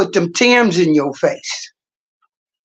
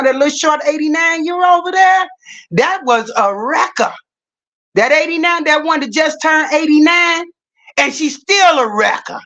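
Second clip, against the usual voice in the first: excited boast; 8 semitones higher than usual. Real, not acted. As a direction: shout it out, proud and hyped. Excited boast about aunt.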